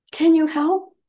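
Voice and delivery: put-on a girl begging to go home; pleading Dorothy. Dorothy running up to Glinda, high and pleading.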